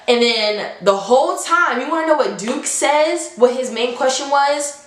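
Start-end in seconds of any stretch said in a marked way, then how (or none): none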